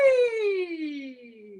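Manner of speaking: high gliding whee falling down; swing whee. Shown as a demonstration. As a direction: slide down like on a swing. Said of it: "you're on a swing and you're gonna go".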